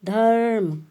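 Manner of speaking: धर्म said clearly with the r joined to ध; teacher's model of धर्म; demo pronunciation. Shown as a demonstration. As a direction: model धर्म slowly for the class. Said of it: "अब हमने क्या बोला".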